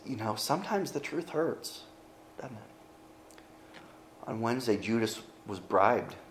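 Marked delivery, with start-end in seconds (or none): 0.0-3.5 hushed. Real, not acted. Sombre aside to congregation.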